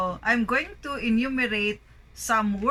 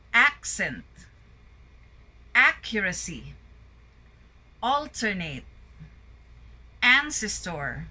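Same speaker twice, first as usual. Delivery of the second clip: isolated words, strong opening syllable, falling tail, long gaps; word-list drill, each first syllable stressed like AC in Accent. Shown as a demonstration. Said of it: "Words with a primary stress on the first syllable".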